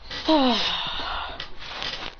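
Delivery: long voiceless exhale; breathy sigh. Acted. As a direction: let all the air out in a sigh. Character sighs after being scolded.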